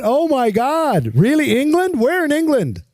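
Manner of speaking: high-pitched, excited disbelief; acted amazement. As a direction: gush in amazement as if meeting a stranger from abroad. Acting amazed at a stranger's origin.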